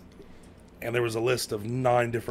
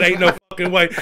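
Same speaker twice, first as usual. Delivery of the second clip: high, loud retold outburst; loud disbelief; 6 semitones higher, 10 dB louder than usual. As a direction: blurt it out in disbelief. Retelling his frantic jail phone call.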